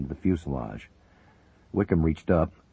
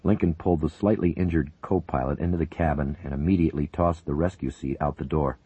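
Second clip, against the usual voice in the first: ordinary delivery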